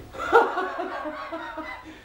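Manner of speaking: very high squeaky cackle; tiny dwarf laughing at the man. Acted. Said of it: "he starts laughing".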